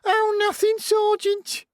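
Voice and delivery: very high, sneering parody; mocking falsetto. A narrator's reading. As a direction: mimic him in a squeaky falsetto. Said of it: "parodied Scarlett in a high falsetto".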